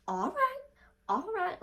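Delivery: sudden very high squeaky backing-down voice; mock squeak. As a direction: squeak it out like a scared boy. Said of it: "like that".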